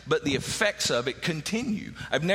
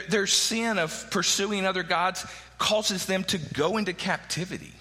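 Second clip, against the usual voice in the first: usual speaking voice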